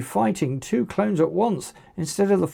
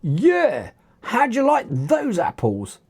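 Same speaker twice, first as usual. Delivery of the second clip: higher-pitched taunting call; 6 semitones higher than usual. A narrator's reading. Dylan calls out after getting to his feet, called-out taunt. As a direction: call it out to the one he has hit. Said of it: "called out Dylan".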